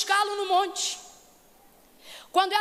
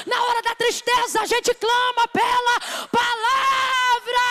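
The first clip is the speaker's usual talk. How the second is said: louder, higher preaching build; full-force preaching; 5 semitones higher, 4 dB louder than usual. Acted. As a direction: preach it rising to a shout. (pregação inflamada, crescendo até gritar)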